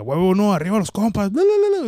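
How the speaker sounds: high, loud celebratory yell into a babbled bla bla; catchphrase yell. Retold or imitated. A creator shouting his catchphrase to celebrate buddies. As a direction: shout it like a victory cry. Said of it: "ese grito, güey, me salió del alma".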